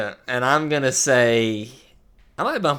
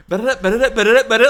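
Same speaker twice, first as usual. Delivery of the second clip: editing stutter loop; 10 semitones higher, 7 dB louder, much faster than usual. Retold or imitated. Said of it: "that thing in editing".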